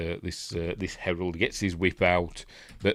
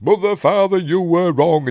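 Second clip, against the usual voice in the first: higher and louder mock cry of betrayal; hammed-up anguished shout; 10 semitones higher, 8 dB louder than usual. Ephialtes cursing his parents, hammed-up high shout. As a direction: wail it up at the sky.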